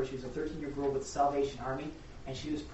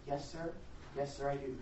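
meek reply; 8 dB quieter than usual. Retold or imitated. Girl to mocking lawyer, quiet and meek. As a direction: answer quietly, without rising to the taunt.

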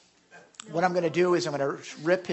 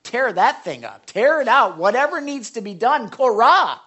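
God's urging; 3 semitones higher, 7 dB louder than usual. Retold or imitated. God urging his people to rend their hearts, building to loud. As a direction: start calm, then rise to a loud urgent plea. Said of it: "God's saying".

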